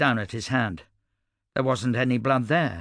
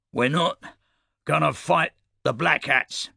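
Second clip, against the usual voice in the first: raised voice; 4 semitones higher than usual. A narrator's reading. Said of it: "he said, raising his voice".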